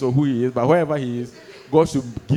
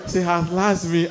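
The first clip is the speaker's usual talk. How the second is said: ordinary talk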